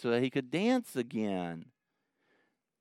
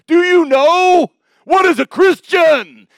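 very loud, much higher, slow preacher roar; pulpit-pounding yell; 14 semitones higher, 16 dB louder, slower than usual. Retold or imitated. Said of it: "He'd pound on the pulpit".

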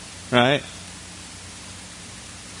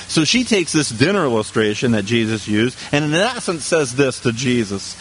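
normal talking voice

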